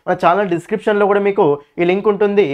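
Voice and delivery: teacher plugging his course app to students, lively ad read. Acted. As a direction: pitch the course like a promo.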